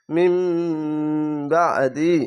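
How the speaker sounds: মিম with a sustained closed-lip nasal before বা; iqlab ghunna. Shown as a demonstration. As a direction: hum the final m of মিম long, then release "বা দি". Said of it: "এটি হচ্ছে ইকলাব গুন্না".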